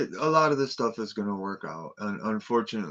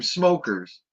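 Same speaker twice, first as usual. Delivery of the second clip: raised, louder call of the word; retold shout; 6 semitones higher, 6 dB louder than usual. Retelling the villagers' alarm in a raised call. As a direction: call it out a second time with less force. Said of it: "They'd scream".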